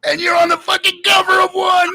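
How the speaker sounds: very high, excited retold shout; yelled reveal. Buddy finds him on a video cover, shouting. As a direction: imitate a buddy shrieking the big news.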